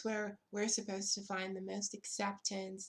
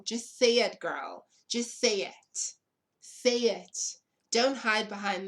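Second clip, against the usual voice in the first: louder, daring them to just say it; challenging shout; 7 dB louder than usual. Real, not acted. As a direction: call them out, loud and pointed. Calling out racists directly.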